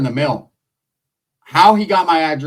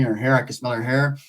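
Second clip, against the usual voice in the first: normal talking voice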